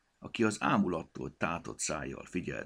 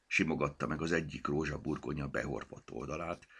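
ordinary delivery, nothing marked

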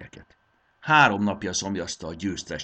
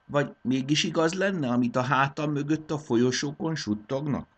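usual speaking voice

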